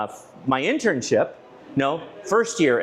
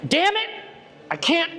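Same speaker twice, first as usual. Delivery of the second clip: retold curse, yelled much higher; angry outburst. Scoutmaster fumbling with the repeater, furious yell. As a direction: Scoutmaster swearing at a radio he can't program, angry outburst. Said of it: "he's pissed off".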